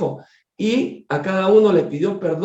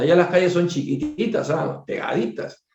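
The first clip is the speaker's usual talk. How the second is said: ordinary talk, nothing marked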